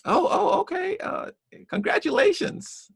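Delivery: high mock cop voice, flustered; cop backing off. Cop answering the homeowner building his house, high mock voice. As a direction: play the cop caught out, voice pitched high. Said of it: "And I said".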